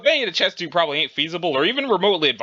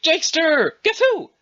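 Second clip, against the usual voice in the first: high, loud sing-song greeting; playful call out; 11 semitones higher, 3 dB louder than usual. Acted. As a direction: surprise him cheerfully. Roxy calling out to Jake.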